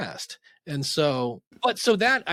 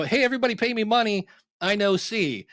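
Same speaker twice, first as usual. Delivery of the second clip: higher, louder, fast hammed-up brag; mock boastful shout; 7 semitones higher, 3 dB louder than usual. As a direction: brag to the whole street like a beginner. Mock beginner shouting in street.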